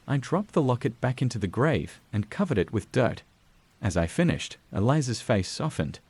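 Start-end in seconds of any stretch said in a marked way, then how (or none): none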